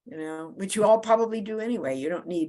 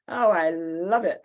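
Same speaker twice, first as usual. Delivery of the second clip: normal talking voice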